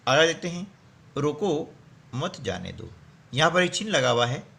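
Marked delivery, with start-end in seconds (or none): 1.2-2.9 "रोको", then a pause, then "मत जाने दो"; pause after रोको. Shown as a demonstration. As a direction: stop on रोको, say मत जाने दो as one unit. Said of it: "इस चिन्ह का नाम है अल्प विराम". ("रोको" के बाद विराम, फिर "मत जाने दो")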